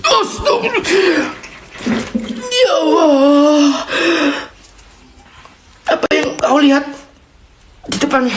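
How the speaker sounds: high-pitched, real fright; frightened outcry. Real, not acted. Ghost hunter seeing something ahead, high frightened cries. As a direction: cry out to God, badly scared.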